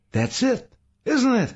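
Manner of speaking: very high, urgent gasp; gasped realisation. A narrator's reading. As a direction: gasp it as the truth hits you. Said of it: "Saul gasped".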